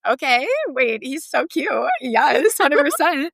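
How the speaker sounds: a thrilled high squeal of yes; giddy excitement. Retold or imitated. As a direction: swoon over the message. Reacting to a cute guy's message.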